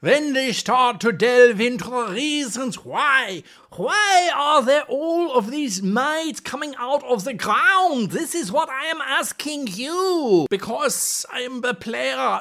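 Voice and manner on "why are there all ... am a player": higher put-on accent, pushing questions at the GM; player in a put-on accent. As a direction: play a curious player with an odd accent. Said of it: "all the accents today".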